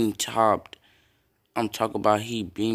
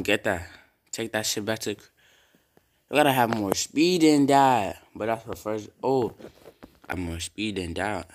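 normal talking voice

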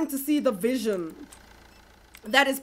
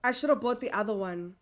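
much softer, lower muttered repeat; quiet regret; 6 semitones lower, 8 dB quieter than usual. Real, not acted. Shop owner regretting the cheaper fridge, soft muttered regret.

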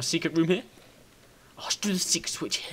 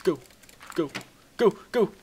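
ordinary delivery

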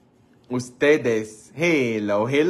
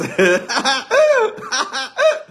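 loud, very high laugh; high cackle; 11 semitones higher, 5 dB louder than usual. Real, not acted. Comedian after sending fans kisses, high cackle. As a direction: burst out cackling. Cracks up at own joke.

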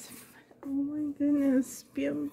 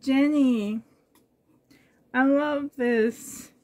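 ordinary delivery

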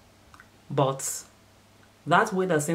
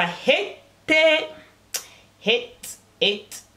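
playful cue call; 10 semitones higher, much slower than usual. Pretend. Cueing a sound drop.

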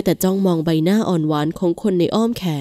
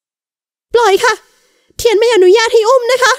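much higher, louder, slow protest; a young wife refusing hard, much higher; firm voice; 6 dB louder, slower than usual. A narrator's reading. Wife protesting being carried by her husband: firm, higher, louder. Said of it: "เอ่ยขึ้นเสียงแข็ง".

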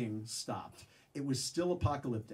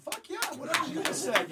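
mock eager fan; 14 semitones higher than usual. Mocking himself clapping along.

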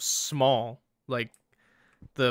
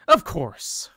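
loud, put-on server voice; takeaway worker; 5 semitones higher, 7 dB louder than usual. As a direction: answer the customer emphatically in character.

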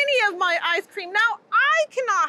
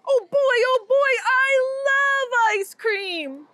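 excited; 6 semitones higher, slower than usual. Acted. Character thrilled about ice cream.

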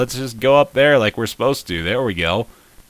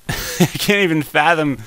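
ordinary talk, nothing marked

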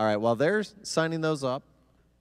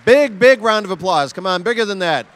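loud, raised emcee call; rousing the crowd; 8 semitones higher, 12 dB louder than usual. Real, not acted. Host asking the audience for bigger applause, calling out. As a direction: whip up the applause.

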